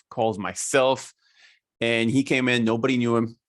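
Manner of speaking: ordinary talk, nothing marked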